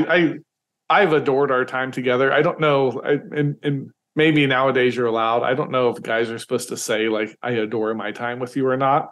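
usual speaking voice

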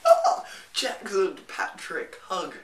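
high squealing greeting of friends; excited squeal. Retold or imitated. Greeting Jackson and Patrick, squealing excitedly. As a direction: shriek their names with delight. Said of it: "and I was like".

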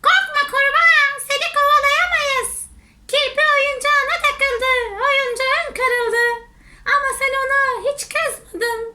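very high, quick bee voice; squeaky, loud little bee comforting a friend; reassuring bee. Acted. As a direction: chirp it high and fast like a bee. Bees reassure the frog.

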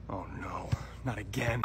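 low, hushed whisper to self; soft whispered dismay; dread-filled whisper. Acted. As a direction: whisper it as panic creeps in. Player dreads having the ball.

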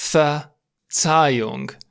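"Verzeihung" very slow, pause after Ver; syllable by syllable. Shown as a demonstration. Teacher sounding out the final word, slow split "Verzeihung". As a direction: break "Verzeihung" into Ver, then zeihung.

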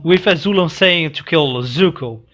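normal talking voice

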